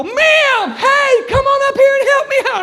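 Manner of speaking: very high, loud panicked call-up; acted squealing holler; nervous shout. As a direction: blurt it at the top of the voice from nerves. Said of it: "nerves, scary, I don't know".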